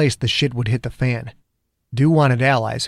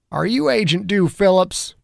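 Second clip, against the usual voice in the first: gruff cop, pitched up a touch; lightly voiced detective; Zimmer's voice; 7 semitones higher than usual. A narrator's reading. Detective confronting federal agent, lightly voiced.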